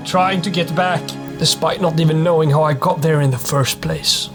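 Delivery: ordinary talk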